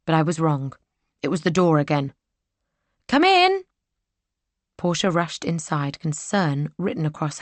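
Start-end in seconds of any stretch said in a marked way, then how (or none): 3.1-3.6 called out. Acted. Heroine calls to door.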